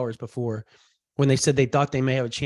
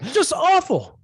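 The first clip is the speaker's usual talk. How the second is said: very high, louder mock despair; exaggerated wail; 13 semitones higher, 5 dB louder, slower than usual. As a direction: overdo the dismay, pitched way up. Repeats it even higher.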